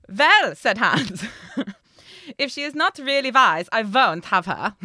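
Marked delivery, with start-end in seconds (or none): 0.1-0.5 high, very loud campy German "Vell"; hammed-up Edna-style German accent; camp German accent. A narrator's reading. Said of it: "he got camper". 2.4-4.8 loud, hammed-up German accent; camp German accent. A narrator's reading. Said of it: "he got camper".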